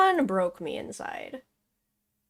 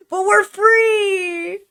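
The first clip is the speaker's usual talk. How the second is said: slow, high, loud cry of relief; joyful shout; 9 semitones higher, 6 dB louder, much slower than usual. Real, not acted. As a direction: yell it out with tearful joy. Streamer cheers, game finished.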